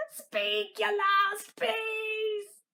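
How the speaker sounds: hammed-up villain demand; menacing demand. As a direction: stretch out the threat. Villain demands last words.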